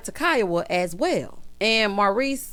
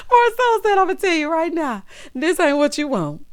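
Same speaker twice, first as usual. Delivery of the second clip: higher and louder, words squeezed out through laughter; laughing through it; 7 semitones higher, 5 dB louder than usual. Real, not acted. Retelling Marceau's line while still cracking up. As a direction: keep laughing as you speak.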